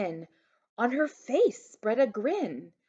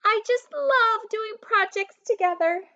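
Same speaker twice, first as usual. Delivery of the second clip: yelled; 14 semitones higher, 6 dB louder than usual. A narrator's reading. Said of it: "Mabel yelled through the door".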